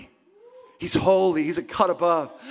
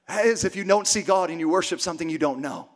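ordinary delivery

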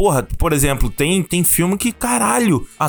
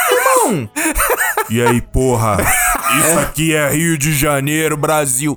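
hammed-up movie tough guy; 3 semitones lower than usual. Mocking the acting in Velozes e Furiosos, hammed-up action-hero voice. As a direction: parody a bad action-film performance. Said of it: "tá shakespeariana ali".

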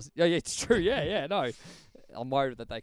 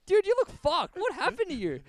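a lightly voiced, much higher mock shocked tourist; shocked friends; 7 dB louder than usual. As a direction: squeak it out like an alarmed mate. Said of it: "They're like".

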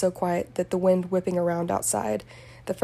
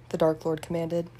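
ordinary delivery

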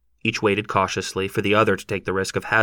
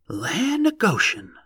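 subdued exclamation; 10 semitones higher, slower than usual. A narrator's reading. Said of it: "a subdued voice exclaimed".

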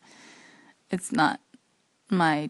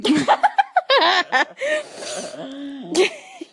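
laughing; 8 semitones higher, 7 dB louder than usual. Real, not acted. Laughing at butler request.

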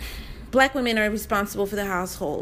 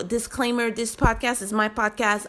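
ordinary delivery

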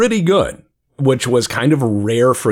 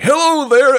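booming greeting; 6 dB louder than usual. Retold or imitated. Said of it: "you were like".

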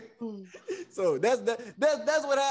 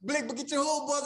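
normal talking voice

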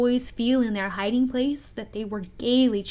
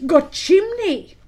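high, slow, loud cry of discovery; exclaiming; 9 semitones higher, 8 dB louder, slower than usual. A narrator's reading. Both pirates finding the hidden chimney, exclaiming high and loud. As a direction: exclaim it as a sudden revelation. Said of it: "they both exclaimed".

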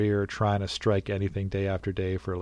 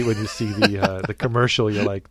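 normal talking voice

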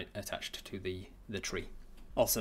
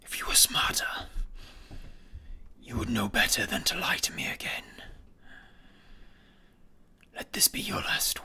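slow sinister whispering villain; menacing whisper. Acted. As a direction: whisper each threat slowly, coldly. Villain dragon threatens and bargains.

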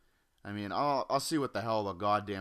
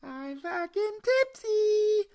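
put-on much higher, slow squeaky teen voice; mock tipsy teen; 5 dB louder, slower than usual. Mocking a sixteen-year-old drunk on two beers, much higher and slow.

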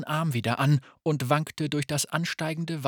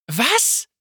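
sudden high, loud gasp of disbelief; shocked; 11 semitones higher, 6 dB louder than usual. Acted. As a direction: snap out in shock. Fayette shocked by Gavin's confession.

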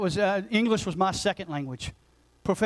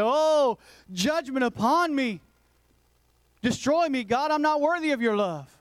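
anguished plea; 6 semitones higher than usual. Retold or imitated. Isaiah falling before God.

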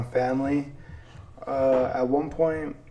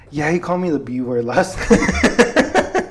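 mock tattling kid, higher and louder, breaking into laughter; laughing imitation; 4 semitones higher, 9 dB louder than usual. Little cousin telling his mom, imitated higher and louder through laughter.

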